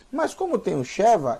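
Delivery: normal talking voice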